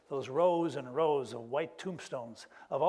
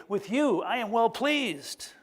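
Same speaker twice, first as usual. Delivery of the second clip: blessing; 5 semitones higher, 3 dB louder, slower than usual. Pretend. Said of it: "I wanted to say".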